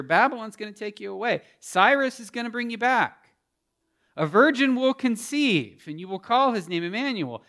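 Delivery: loud, high, ringing sermon climb; impassioned pulpit preaching; full-force preaching. Acted. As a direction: proclaim it to the back row. Preaching God's promises at full force.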